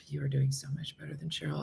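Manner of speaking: soft, breathy pretend whisper; whispered aside. Imagining friends comforting her, whispered aside. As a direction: lean in and whisper it.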